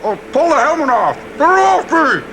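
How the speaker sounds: hammed-up panic shout; panicked. Riffing panic in helmet.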